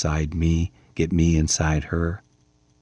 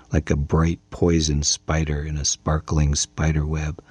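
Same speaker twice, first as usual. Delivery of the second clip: ordinary delivery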